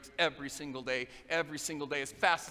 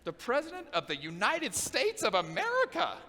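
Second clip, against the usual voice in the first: higher, slow, grand declaration; quoting the governor; 8 semitones higher, slower than usual. Retold or imitated. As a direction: say it like a big dream announced to the world. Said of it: "he said".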